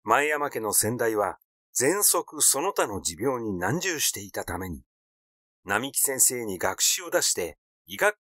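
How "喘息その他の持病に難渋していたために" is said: ordinary delivery, nothing marked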